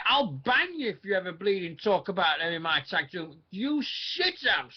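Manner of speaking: high, slow, furious ranting; outraged rant. Real, not acted. Outraged rant at tag team pick.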